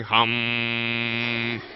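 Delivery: low, sustained mock chant; meditation hum. Speaker joking about meditating to the phone, low droning hum. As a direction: drone it like a pretend meditator. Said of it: "start meditating and going".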